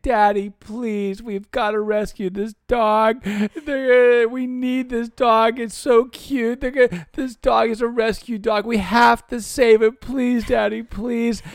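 put-on high, loud, slow pleading little girl; imitating crying daughter. Father mimics his daughter begging on the phone, high pleading whine. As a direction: beg Daddy like a sobbing child. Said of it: "she was bawling".

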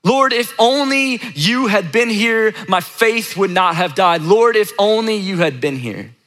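slow, high plea to God; stretched, pleading appeal; honest, unfiltered prayer. Pretend. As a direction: draw out a heartfelt lament. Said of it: "it would look like this".

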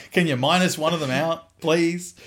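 high, loud begging with a laugh; mock pleading. Dad-to-be begging the sonographer, comically pleading.

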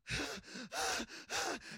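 breathy mock sobbing gasps; imitated ugly crying. Imitating the big security guard bawling, ugly crying. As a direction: act out voiceless, heaving sobs. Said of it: "like, ugly crying. He's just like".

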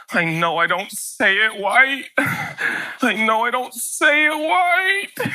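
slow, drawn-out rising whine; little son whining he can't say it right; whiny kid's complaint. Retold or imitated. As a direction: stretch each line like a sulking kid. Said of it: "And he goes".

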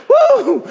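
high excited whoop, real; preacher's ecstatic whoop; joyful high whoop. Real, not acted. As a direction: let out a whoop of praise. Preacher's joyful outburst after laughing.

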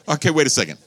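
high, loud pulpit challenge, slowing; loud preaching. Acted. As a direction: press the question on the church. Preaching at full force.

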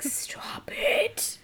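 high, strained whisper; mock hushing. Embarrassed friend tells the alien to quit, mock whisper.

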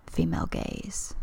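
deep voice with vocal fry; gravelly low voice. Shown as a demonstration. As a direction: drop into a gritty bass. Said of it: "adding some gravel to my voice".

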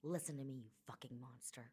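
low quiet threat to a former friend; quiet menace. Acted. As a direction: say it low and deadly. Griana threatens Kai.

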